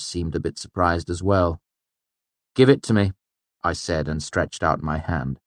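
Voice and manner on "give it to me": raised, firm demand; insistent, pitched-up order. A narrator's reading. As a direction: demand it back up front. Demanding back his lute.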